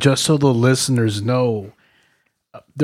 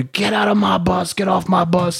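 higher, rasping drill-sergeant order; imitated sergeant's order; 6 semitones higher than usual. Drill sergeant ordering recruits off the bus, imitated bark.

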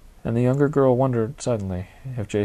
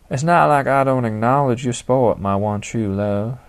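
put-on honeyed Southern accent; 3 semitones higher, 6 dB louder than usual. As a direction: pour Southern honey over each word. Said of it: "Her accent now dripped honey".

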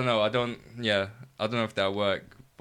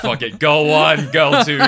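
loud, high, drawn-out self-quote; laughing mock give-up; 5 semitones higher, 12 dB louder than usual. Writer explains lazy names given under time limit, laughing and loud. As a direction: toss it out loud and slow, half laughing. Mimics own rushed naming choice, joking.

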